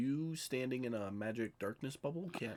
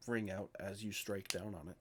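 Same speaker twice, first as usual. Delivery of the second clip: ordinary talk, nothing marked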